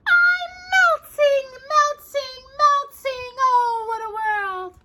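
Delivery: high wailing witch cry, slowing as she sinks; very high, slow wicked witch wail; melting wicked witch. Acted. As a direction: wail like a witch dissolving away. Witch melts from water.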